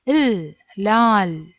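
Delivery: isolated ഇൽ, pause, then ലാൽ ending on bare L; final L demo. Shown as a demonstration. Teacher repeats the unmarked-letter rule, showing ഇൽ then ലാൽ. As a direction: model ഇൽ separately, then the whole ലാൽ. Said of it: "അതിനെ എങ്ങനെ ഉച്ചരിക്കും?".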